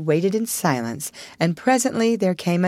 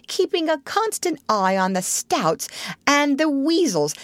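higher-pitched, insistent scolding; reproachful; 7 semitones higher than usual. A narrator's reading. Rat scolding Toad over his loyal friends, reproachfully. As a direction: lay on the guilt, point after point. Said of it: "said the Rat reproachfully".

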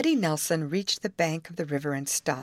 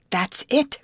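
high, louder burst of realisation; excited cry; 7 semitones higher, 5 dB louder than usual. A narrator's reading. Molly seizing on the alligator idea, excited cry. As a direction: cry it out, pitched up. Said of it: "cried Molly".

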